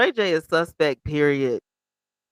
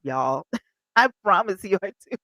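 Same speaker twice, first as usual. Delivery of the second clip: ordinary talk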